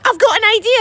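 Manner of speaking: high, loud eureka; excited girl. Acted. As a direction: blurt the idea. Girl voiced.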